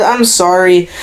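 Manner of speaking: usual speaking voice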